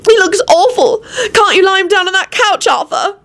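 high, distressed wail. A narrator's reading. Elizabeth begging Arthur to lie Lupin down, wailing. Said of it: "Elizabeth wailed".

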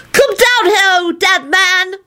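put-on high, drawn-out child's call. A narrator's reading. Children calling a corpse down from the ceiling, hollering. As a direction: sing it out up high.

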